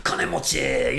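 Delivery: lightly voiced bragging rich neighbor; gösterişçi zengin. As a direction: show off your wealth. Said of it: "they're like, showing off their wealth".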